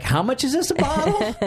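high squeaky mock shock at the price; mock disbelief. Retelling the cost of making pills, mock disbelief. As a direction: act stunned by the cost.

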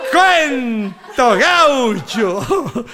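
said through a laugh, very slow; real laughter over the words. Real, not acted. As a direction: stretch it out while laughing. Laughing tag after joke.

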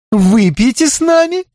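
high bright rising invite; gushing invitation. A narrator's reading. Tipsy Belinda inviting the detective to drink, high rising. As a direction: coax him over, sweetly. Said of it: "восторженно воскликнула Белинда Рид".

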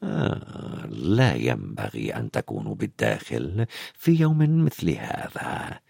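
very low, soft, creepy smile in the voice; quiet, oily villain voice; Snape, sinister. A narrator's reading. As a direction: chide them smoothly, deep and hushed, slowing at the end. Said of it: "يبتسم ابتسامة غريبة مريبة".

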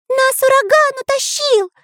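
very high panicked shout; shouting back. Acted. The artist answering the spy, very high shout. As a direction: yell it way up over the wind. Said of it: "кричал ему в ответ Карандаш".

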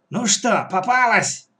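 high, gloating question; triumphant. Acted. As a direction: gloat over a captive. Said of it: "торжествующе спросила она".

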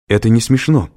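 cross, loud retort; angry. A narrator's reading. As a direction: put a bad joke down firmly. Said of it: "сердито заявила Кэтрин".

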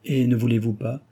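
usual speaking voice

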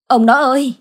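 high, loud alarmed shout; panicked cry. A narrator's reading. Wife finding her husband lying on the ground, panicked cry. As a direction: call out in sudden panic. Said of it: "bà đã hốt hoảng la lên".

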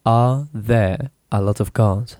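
"Are there a lot of cars?" said slowly in chunks; teacher splitting the question slowly; broken into pieces. Shown as a demonstration. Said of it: "divide the sentence into small pieces".

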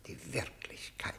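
soft unvoiced whisper; a stunned whisper echoing the interrogator. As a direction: breathe the word back to himself. Prisoner echoes the word, shaken.

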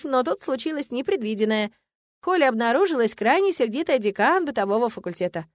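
ordinary delivery, nothing marked

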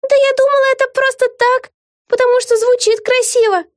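very high-pitched yell; yelling back. A narrator's reading. Vega nearly flies overboard, yelling in protest. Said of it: "проорала Вега".

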